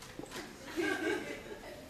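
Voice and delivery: audible huff of annoyance; exasperated sigh. Shown as a demonstration. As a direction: demonstrate a wordless fed-up sigh. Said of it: "this is another uff".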